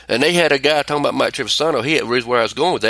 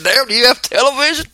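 disbelieving; 11 semitones higher than usual. Retold or imitated. Mocking clueless co-host on air.

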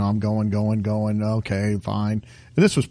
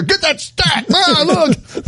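mock alarm; 12 semitones higher, much slower than usual. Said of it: "Don't come in there".

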